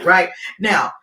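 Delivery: normal talking voice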